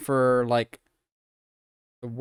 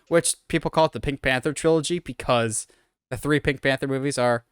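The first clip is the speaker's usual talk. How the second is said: ordinary talk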